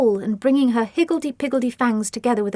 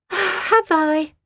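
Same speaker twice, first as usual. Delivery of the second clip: higher mock-innocent sigh; breathy mock surprise; 9 semitones higher, 3 dB louder than usual. A narrator's reading. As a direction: Asti sighing at the accusation. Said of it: "sighed Asti".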